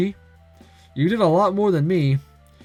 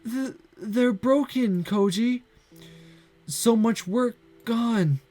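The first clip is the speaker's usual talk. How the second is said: put-on despairing girl Santa; 8 semitones higher, slower than usual. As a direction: let her hope drain away word by word. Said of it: "looking in despair".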